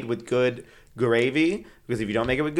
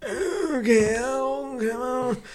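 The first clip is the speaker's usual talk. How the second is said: put-on old man voice; 9 semitones higher than usual.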